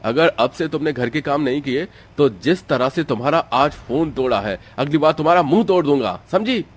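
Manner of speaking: furious acted dressing-down, speeding up at the threat; angry husband. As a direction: threaten your wife in a deep voice. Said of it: "वो गुस्से से".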